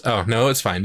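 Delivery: normal talking voice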